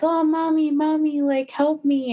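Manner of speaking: put-on high, pleading little-girl voice; childlike voice. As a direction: imitate a child calling for mommy, pitched well up. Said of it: "childlike voice and be like".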